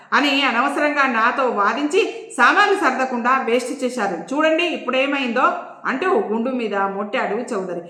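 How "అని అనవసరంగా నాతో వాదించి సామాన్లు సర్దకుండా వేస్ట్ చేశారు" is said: ordinary delivery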